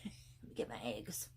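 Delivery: low, soft, slow caveman grunt; cave woman. Retold or imitated. Mocking herself as a cave woman, low grunt. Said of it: "I look like a cave woman here".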